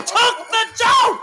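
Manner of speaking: high, loud indignant shout; real outrage, belting it out; outraged shout. Real, not acted. Telling the friend how Bunny stole Sherry's joke, shouting in outrage.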